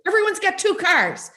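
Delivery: put-on high, fast old Italian grandpa grumble; ranting like an old Italian grandpa; grumpy grandpa impression. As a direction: mimic a cranky old grandpa complaining. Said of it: "would always be like".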